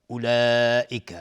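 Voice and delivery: long-held vowel in اولئک; prolonged madd. Shown as a demonstration. Teacher shows the obligatory madd in اولئک, drawn-out vowel. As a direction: stretch the long vowel of اولئک for four or five beats. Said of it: "مد واجب متصل به مقدار چهار تا پنج حرکت".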